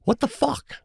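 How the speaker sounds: high-pitched mock outrage; retold anger. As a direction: act out confronting someone, pitch leaping up. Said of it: "I was definitely more mad".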